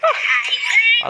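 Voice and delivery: high-pitched scream of fright; startled scream. Real, not acted. Horror gamer caught off guard, startled scream. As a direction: cry out "ah" high and sudden.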